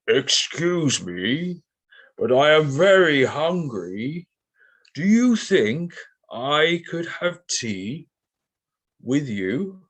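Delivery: slow, high, drawn-out tiger voice; silly tiger voice. Acted. Teacher voices the tiger asking Sophie for tea, silly and high. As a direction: ham up a hungry but polite tiger. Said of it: "put on a, a silly voice for the tiger".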